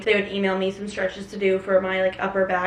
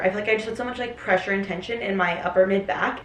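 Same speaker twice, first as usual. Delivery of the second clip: usual speaking voice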